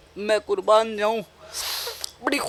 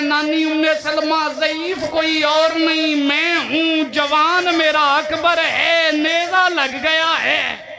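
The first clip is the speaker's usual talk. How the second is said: high, slow, shouted lament; weeping outcry; 4 semitones higher than usual. Retold or imitated. Husain answering his grieving grandmother, high slow shouted lament. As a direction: cry the answer out, dragging each phrase in grief. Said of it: "रो के कहते हैं".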